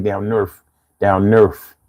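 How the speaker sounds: imitating Philly accent. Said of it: "But now they be like".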